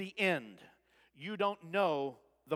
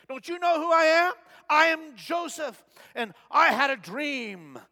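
indignant Joseph; 8 semitones higher, 8 dB louder than usual. Acted. Joseph in shackles protesting to his captors, indignant shout. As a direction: demand recognition as you're led off.

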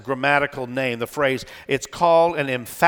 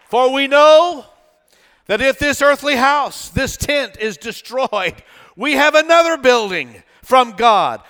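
high, loud, exultant pulpit proclamation; full-force preaching; 6 semitones higher, 7 dB louder than usual. Real, not acted. Preacher proclaiming scripture to the congregation, full-force preaching. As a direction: proclaim it to the whole church, ringing out.

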